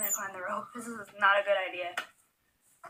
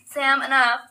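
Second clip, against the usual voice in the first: scolding; 3 semitones higher, 12 dB louder, slower than usual. Real, not acted. Scolding the pet Sam.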